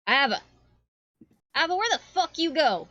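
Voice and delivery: loud, high-pitched angry yell; big shout. Acted. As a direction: shout for someone who has wandered off. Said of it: "you just hear this big shout of".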